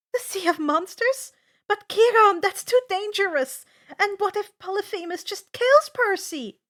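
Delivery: loud, high, alarmed objection; alarmed protest. A narrator's reading. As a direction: push back hard against the plan. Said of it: "Annabeth protests".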